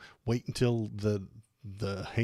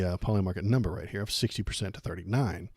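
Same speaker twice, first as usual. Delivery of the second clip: ordinary talk, nothing marked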